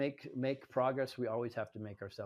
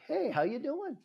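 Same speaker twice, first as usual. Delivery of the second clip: high, loud, friendly hello back; cheery greeting; 14 semitones higher, 5 dB louder than usual. Retold or imitated. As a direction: greet him back brightly. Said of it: "they're like".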